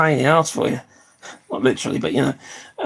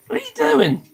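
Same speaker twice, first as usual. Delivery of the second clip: much higher squeal at the pet; squeaky pet talk. Real, not acted.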